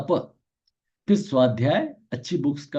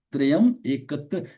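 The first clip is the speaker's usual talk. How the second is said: usual speaking voice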